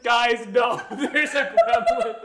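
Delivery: loud, high and stretched-out insistence; hammed-up warning breaking into laughter; insistent mock yell. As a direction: plead loudly that it's true. Voicing woman insisting to disbelieving men.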